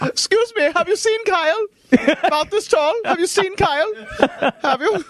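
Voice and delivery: very high cartoonish asking voice; put-on character voice. Host doing a bit after Hitler jokes, high character voice.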